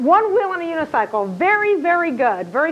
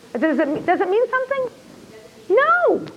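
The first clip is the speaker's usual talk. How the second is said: incredulous; 8 semitones higher than usual. Retold or imitated. Retold exchange with students.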